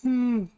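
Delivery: long loud yawn. Real, not acted. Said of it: "I keep yawning".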